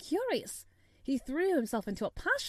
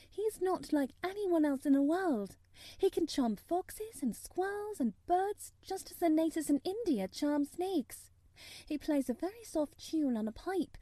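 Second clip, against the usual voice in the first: put-on higher-pitched girl explaining; child character, pitched up and earnest; Mary, eager; 6 semitones higher than usual. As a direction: explain it in a girl's lifted voice. Voices Mary describing Dickon.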